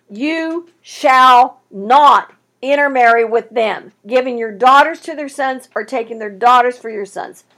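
preacher hammering the verse for the congregation; emphatic reading. Acted. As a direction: read it like a final command.

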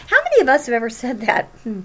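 ordinary delivery

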